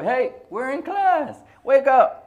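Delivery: high, loud, slow call to wake up. Retold or imitated. Teacher rousing a sleeping sister in class, high slow shout. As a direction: call out sharply to a dozing student. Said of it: "she has to wake her up".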